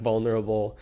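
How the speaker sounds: ordinary talk, nothing marked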